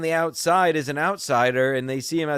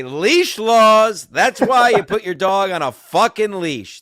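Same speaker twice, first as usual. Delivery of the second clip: loud, higher indignant yell; angry retort; 7 semitones higher, 8 dB louder, slower than usual. Retold or imitated. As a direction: retell an angry shout at a stranger. Retold altercation with dog owner.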